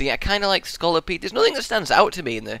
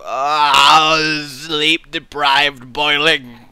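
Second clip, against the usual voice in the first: groggy; much slower than usual. Real, not acted. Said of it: "I'm in a kind of".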